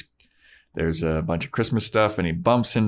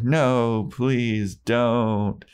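slow, stretched, higher begging; drawn-out mock plea; 3 semitones higher, much slower than usual. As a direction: draw out each word of a hammy plea. Daniel pleads a second time.

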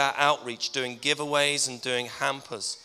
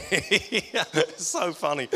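talking through laughter, pitched up; laughing; 4 semitones higher than usual. Real, not acted. Preacher retelling a funny Santa story, laughing. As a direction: barely get it out for laughing.

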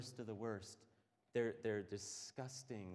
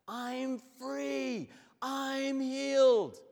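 much higher and much louder, slow exultant cry; joyful shout; 17 dB louder, much slower than usual. Retold or imitated. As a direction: shout it out, freed at last. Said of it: "Now he shouts".

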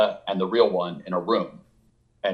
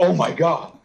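higher-pitched starstruck gasping words; imitated stunned fan; 7 semitones higher, 3 dB louder, slower than usual. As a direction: play a fan too awed to breathe. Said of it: "would lose the ability to breathe".

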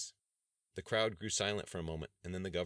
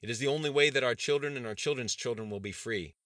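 higher, louder rousing political speech; rally the crowd like a defiant governor; stirring speech from a podium; impassioned governor; 5 semitones higher, 7 dB louder than usual. A narrator's reading. Governor's speech read aloud.

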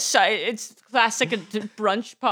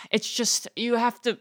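ordinary talk, nothing marked

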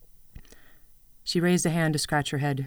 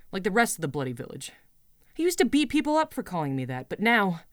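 higher, heated complaint; angry rant; 5 semitones higher, 3 dB louder than usual. Acted. Girl ranting to her goat about Jamie, angry. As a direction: vent the hurt, voice climbing.

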